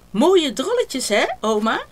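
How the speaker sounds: put-on high, bright child voice showing off; proud little girl. A narrator's reading. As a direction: Dida proudly presenting her cookies. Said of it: "zegt ze". (trots hoog meisjesstemmetje)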